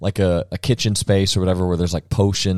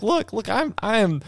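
high, pleading mock confession; exasperated prophet; 10 semitones higher than usual. Paraphrasing the proverb writer, a high, pleading mock confession. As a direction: throw up your hands like the speaker in Proverbs. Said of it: "He's kinda saying".

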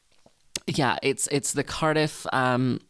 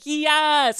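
much higher, louder cheering yell; excited scream; 13 semitones higher, 5 dB louder than usual. Retold or imitated. Fan retelling screaming in delight at the show. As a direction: cheer like a thrilled audience member. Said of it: "I was truly screaming".

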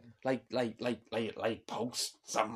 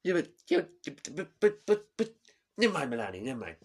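put-on loud, high stammering Trump accent; flustered Trump impression; 7 semitones higher, 10 dB louder, faster than usual. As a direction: splutter like Trump caught out. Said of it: "I can't get out of his accent now".

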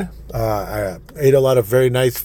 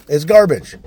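garbage shouted louder and higher; host condemning rap music, shouting it; loud repeat; 6 semitones higher, 8 dB louder than usual. Real, not acted. Real angry shout.

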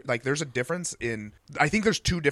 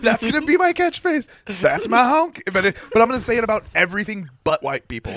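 laughing; 9 semitones higher, 7 dB louder than usual. Real, not acted. Laughing about new catchphrase.

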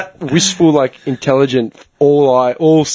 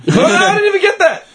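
high-pitched protesting squeal; indignant outburst. Real, not acted. Complaining to friends about mum's lack of acknowledgement, indignant. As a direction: blurt it out, much higher than usual.

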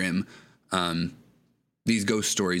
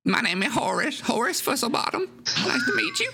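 put-on high squeaky old man; rambling odd host voice; Horace voice; faster than usual. GM keeps Horace voice.

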